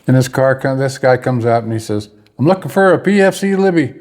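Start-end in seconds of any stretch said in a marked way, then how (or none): 2.4-3.9 high-pitched summons; retold calling out. Driver looking for a private, retold calling out. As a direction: call out a name as if searching.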